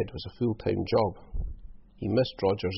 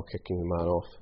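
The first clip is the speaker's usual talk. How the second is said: ordinary delivery, nothing marked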